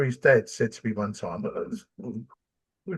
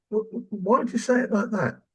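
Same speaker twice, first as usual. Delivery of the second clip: asks it higher, open and puzzled; higher, puzzled "why did you say it like that"; curious questioning voice; 10 semitones higher than usual. Shown as a demonstration. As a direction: show how to ask why, genuinely curious. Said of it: "That's what we gotta do".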